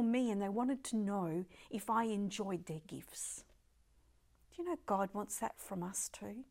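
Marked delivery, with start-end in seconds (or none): 4.5-6.4 hushed, breathy whisper to the congregation. Real, not acted. Preacher whispering about God's grace to the congregation. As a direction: lean in and whisper it.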